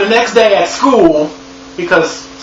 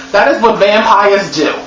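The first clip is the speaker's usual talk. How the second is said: ordinary talk, nothing marked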